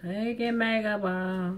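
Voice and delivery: slow, stretched "Taking makeup off"; drawn-out repeat. Shown as a demonstration. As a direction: drag out every word of "makeup". Repeats phrase to camera.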